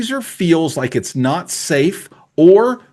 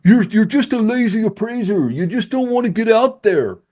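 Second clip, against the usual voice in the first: higher, sneering voice of a critic; mock hater; 9 semitones higher than usual. Appraiser mimics haters calling him lazy, higher and sneering. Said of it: "you haters that are gonna get on there and say".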